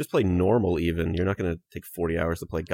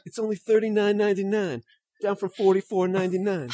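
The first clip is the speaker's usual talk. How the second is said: high, robotic Pete voice; Pete impression; 13 semitones higher, 3 dB louder than usual. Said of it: "That's my best Pete impression".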